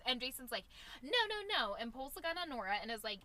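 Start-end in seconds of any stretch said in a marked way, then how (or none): 1.0-1.8 much higher, louder mock panic; panicked no. Jason breaking the trance in a panic, yelping. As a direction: yelp the noes as he snaps out of it.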